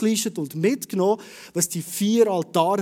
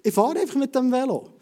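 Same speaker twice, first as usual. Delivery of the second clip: high, louder shrug-off, don't care; defiant brush-off to the bike mechanic, pitched up; mock careless retort; 6 semitones higher, 3 dB louder than usual. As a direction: toss it off like a careless cyclist. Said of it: "Jetzt könnte ich sagen".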